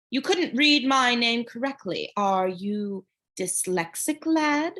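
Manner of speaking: slow, smooth, mocking drawl; silky guard voice. A narrator's reading. Charon mocking Percy from the podium, slow silky. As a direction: tease the boy like a python about to eat.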